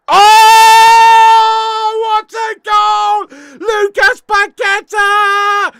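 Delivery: screaming celebration at full volume; long goal yell. Real, not acted. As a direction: roar it out like a goal just went in. Goal celebration on watch-along.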